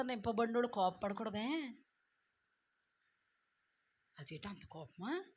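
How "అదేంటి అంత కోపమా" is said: very soft, low mock question; low hushed disbelief; hushed objector. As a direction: imitate a timid critic, barely voiced. Voicing people shocked at anger.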